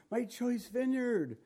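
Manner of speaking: high, soft, drawn-out lament sinking at the end; hammed-up wounded disbelief; mock plaintive God. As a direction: whine it in a put-on high voice, then drop away. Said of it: "So he's looking at Israel like that".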